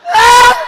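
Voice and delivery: very high, very loud holler; sudden holler. Acted. Brother hollering for no reason, high piercing yell. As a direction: imitate a brother hollering out of nowhere. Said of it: "motherfucker keep hollering".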